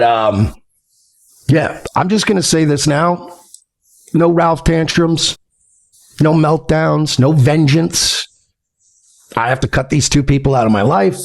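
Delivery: ordinary talk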